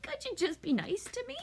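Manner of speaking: high, whimpering plea; whiny crying child. Retold or imitated. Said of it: "sitting there crying".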